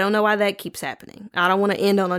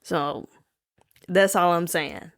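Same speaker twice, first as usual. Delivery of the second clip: usual speaking voice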